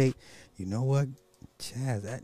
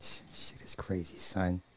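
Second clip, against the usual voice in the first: hushed whisper; 4 semitones lower, 9 dB quieter than usual. Real, not acted. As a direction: breathe the words out. Unvoiced aside.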